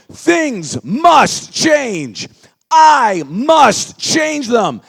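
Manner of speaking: high, very loud slow shout. Shown as a demonstration. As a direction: give it everything. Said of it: "we need a little more energy".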